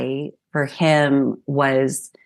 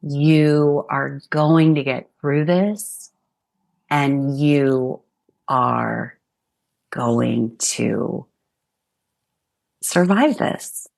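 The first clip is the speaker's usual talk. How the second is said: slow, sincere words to her younger self; tender reassurance; slower than usual. Real, not acted. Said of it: "I would say to four-year-old [name]".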